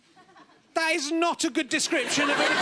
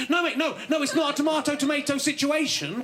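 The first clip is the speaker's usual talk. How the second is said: ordinary talk, nothing marked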